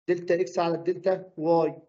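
normal talking voice

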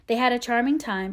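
ordinary delivery

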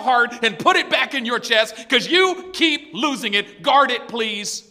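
loud, high, fed-up scolding; exasperated plea. Acted. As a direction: plead loudly, worn out by their carelessness. Preacher acts exasperated giver of hearts.

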